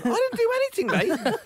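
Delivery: high, laughing possum voice; innocent possum. Pretend. The possum protesting to the caretaker, high and innocent.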